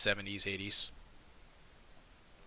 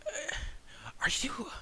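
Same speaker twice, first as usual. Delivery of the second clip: high, hushed awe; breathless wonder; 10 semitones higher, 6 dB quieter than usual. Acted. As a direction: whisper it like seeing something magical. Tilda holds Xander's face, awed.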